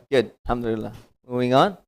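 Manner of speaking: ordinary delivery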